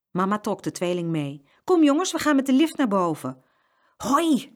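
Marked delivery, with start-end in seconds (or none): 1.6-3.4 high, loud rallying call; mother calling. A narrator's reading. Mama to the twins in the store, calling briskly. 4.0-4.5 high, happy shout; gleeful cheer. A narrator's reading. Twins hearing about the lift, gleeful cheer. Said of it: "riep de tweeling".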